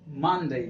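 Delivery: मानदेय with weight on मान, light देय; first-syllable stress. Shown as a demonstration. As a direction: stress मान and let देय fall away. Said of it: "इसको stress देना है".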